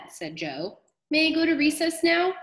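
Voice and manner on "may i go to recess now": put-on high begging child voice; a boy pleading to go out, voice pitched up; whiny recess plea; pleading kid. Joe begs to leave.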